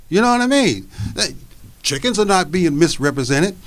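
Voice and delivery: ordinary talk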